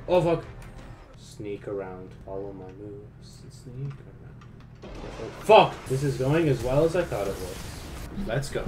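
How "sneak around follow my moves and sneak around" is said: sneaky hush. Pretend. Sneaking past enemies.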